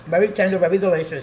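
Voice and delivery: talking with mouth full; words round a bite of food. Real, not acted. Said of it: "sorry for talking with my mouth full".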